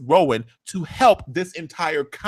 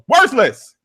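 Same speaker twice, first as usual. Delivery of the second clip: loud, high exclamation; hammed-up scornful pronouncement; contemptuous outburst; 9 semitones higher, 6 dB louder than usual. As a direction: burst out with the insult, theatrically. Acts Rowan's sudden contemptuous outburst.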